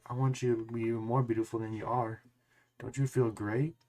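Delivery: lightly mock a creepy sweet-talker; imitating a creepy tone. Mimicking the friend answering a kid, soft creepy sweetness. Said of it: "your tone sounds like a pedophile tone".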